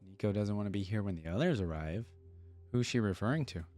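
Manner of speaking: usual speaking voice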